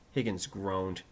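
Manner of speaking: plain reading voice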